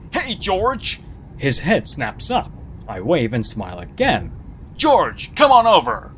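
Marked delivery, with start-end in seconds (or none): 0.1-1.0 high, bright calling out across the street; mock friendly yell. Narrator calls out to the man across the street, high and cheery. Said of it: "I yelled out". 4.7-6.1 loud, high call across the street. Retold or imitated. Narrator loudly calls the nervous man over, forced cheer.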